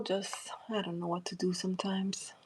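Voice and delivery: breathy whisper, fast, to herself; exasperated whispered aside; whispered exasperation. Real, not acted. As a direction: mutter it under your breath.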